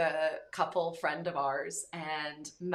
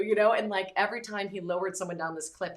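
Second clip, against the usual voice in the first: usual speaking voice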